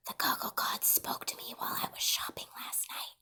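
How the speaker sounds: hushed, solemn whisper. A narrator's reading. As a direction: whisper it like a sacred secret. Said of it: "I whispered solemnly".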